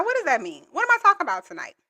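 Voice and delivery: ordinary talk, nothing marked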